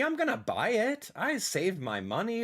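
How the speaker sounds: usual speaking voice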